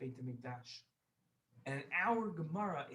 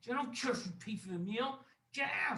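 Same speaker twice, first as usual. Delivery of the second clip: angry refusal; 8 semitones higher than usual. Acted. Wealthy host rejects the guest's offer, angry.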